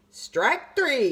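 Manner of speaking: loud, high umpire call; yelled call. A narrator's reading. Umpire calling the strikeout to the stands, loud. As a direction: call it out to the whole park. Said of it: "yelled the umpire".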